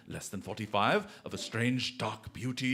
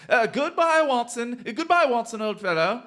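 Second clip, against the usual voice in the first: gleeful farewell; 13 semitones higher, 13 dB louder than usual. Acted. Holmes disguised, gleeful farewell.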